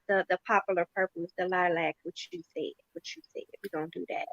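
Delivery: fading into a mumble, the end almost whispered; mumbled. Pretend. Pretend client answering the tech, trailing into a mumble. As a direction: trail off under your breath. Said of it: "Wait, say that again?".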